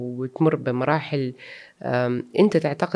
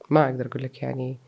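usual speaking voice

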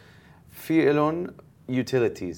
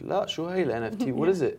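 quick, higher mock confusion; confused question; 7 semitones higher, faster than usual. Retelling to host his clueless reply, a little higher and quick. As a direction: act baffled by what an NFT even is. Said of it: "And I'm like".

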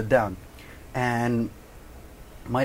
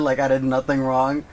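ordinary delivery

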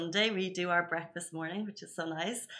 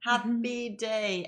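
drawn-out cheer; 3 semitones higher, much slower than usual. Real, not acted.

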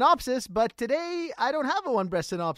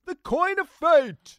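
slow, loud, higher booming proclamation; dramatic announcement; 6 semitones higher, 7 dB louder, much slower than usual. Acted. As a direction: proclaim it like an epic trailer. Echoing coin of fate dramatically.

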